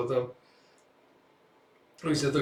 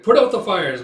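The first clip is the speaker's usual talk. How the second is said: called order; 6 semitones higher, 10 dB louder than usual. Retold or imitated. Commander calling out orders.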